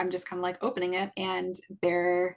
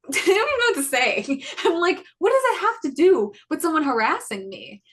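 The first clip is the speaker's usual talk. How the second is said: high, loud, laughing disbelief; exasperated laugh; 9 semitones higher, 7 dB louder than usual. Real, not acted. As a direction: laugh it out in outrage. Exasperated at officer's advice.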